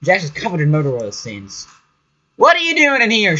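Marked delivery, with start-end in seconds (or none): 2.3-3.4 high, louder stern challenge; raised-pitch stern woman's voice; stern accented woman. A narrator's reading. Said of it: "she asks sternly in an accent that clearly isn't Japanese".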